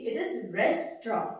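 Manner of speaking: restaurant said slowly as res-tra, no t; correct restaurant. Shown as a demonstration. Teacher models restaurant for students, slow. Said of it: "रेस्टोरेंट नहीं है।".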